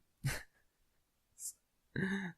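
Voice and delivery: whispered giggle fit; laughter he can't control. Real, not acted. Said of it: "that's totally out of control".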